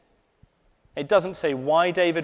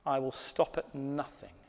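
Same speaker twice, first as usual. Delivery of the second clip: tender voice of God; 3 semitones lower, 8 dB quieter than usual. Acted. God's words to you, tenderly.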